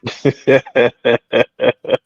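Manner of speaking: long low belly laugh; deep cackling laugh. Real, not acted. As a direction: burst out laughing. Laughing at her own act.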